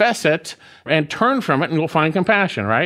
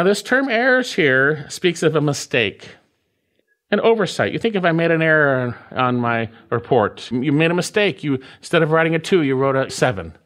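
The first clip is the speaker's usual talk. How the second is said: normal talking voice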